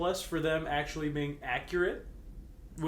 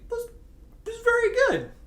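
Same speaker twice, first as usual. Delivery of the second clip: squeaky surprise; 6 dB louder than usual. Acted. Narration jumps high.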